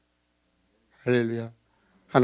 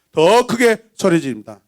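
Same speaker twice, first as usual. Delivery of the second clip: loud, raised exclamation; louder shout; 4 semitones higher, 8 dB louder than usual. Acted. Preacher voicing Leah's cry to the congregation, louder shout. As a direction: push it bigger and higher. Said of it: "내 안은 소리 지릅니다".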